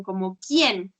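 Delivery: quién said with extra tune and a falling end; stressed question quién. Shown as a demonstration. Teacher demonstrates the accented question word. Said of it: "en el caso de quién ... con acento, sí lleva un poco más de entonación".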